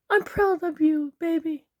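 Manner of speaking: very high falsetto wife voice; adoring wife. Acted. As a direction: praise him in a squeaky put-on wife voice. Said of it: "his wife looks up at him".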